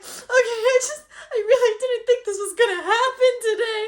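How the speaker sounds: high, teary voice choked with joy; crying happy tears. Retold or imitated. Wife crying with joy to Jake over finding trees, teary and high. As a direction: let the relief break your voice into tears. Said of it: "I cried".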